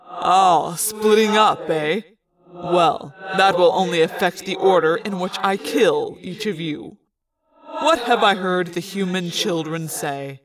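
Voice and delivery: low, cold alien villain voice; menacing villain. Acted. Visser taunting the scattering kids, low and cold. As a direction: gloat slowly over his prey.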